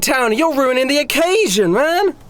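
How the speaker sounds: very high, whining party-dude protest; club partier. Acted. Club dancer stops guys harshing the vibe, protesting. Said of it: "he walks over and goes".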